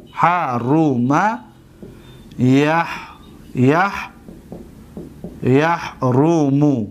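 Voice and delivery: very slow, syllable by syllable; slow dictation. Shown as a demonstration. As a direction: draw out each syllable as if writing it down. Spelling out example while writing.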